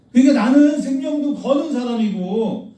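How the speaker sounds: mock self-righteous believer's boast; mocking a proud believer. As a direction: sneer down at the one who can't give his life. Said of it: "이 얘기를 하는 거야".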